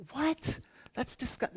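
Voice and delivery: high-pitched, mock-appalled "what?"; shocked disbelief. Diner reacting to a waiter's fish-oil salad, shocked. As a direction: recoil in exaggerated shock, voice shooting up.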